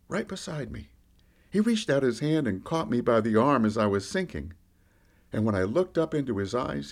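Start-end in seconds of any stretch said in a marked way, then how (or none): none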